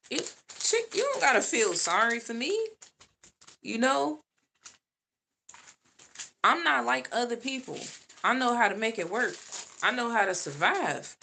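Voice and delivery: sassy reply. Retold or imitated. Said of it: "I'm like".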